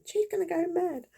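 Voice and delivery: lightly hammed-up worried father; worried dad. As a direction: repeat the dad's dread. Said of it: "He said".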